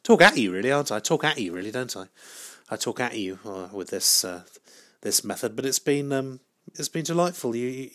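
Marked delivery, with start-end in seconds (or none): none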